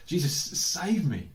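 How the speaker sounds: loud, slow desperate cry to Jesus; crying out for help. Retold or imitated. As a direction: call out for rescue like you're sinking. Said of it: "he cries out".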